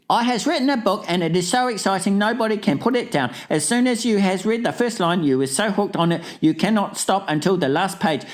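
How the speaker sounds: put-on very high, racing child voice; boastful child writer. Child brags of an exciting book, high fast voice. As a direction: gabble it high and fast, bursting with pride. Reads last dream label aloud.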